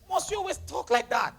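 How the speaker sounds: usual speaking voice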